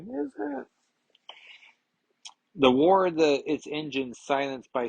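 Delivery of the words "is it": squeaky high skeptical question; a sudden high sarcastic doubt; mock doubt. Podcaster doubts the artist's choice, high mock skepticism.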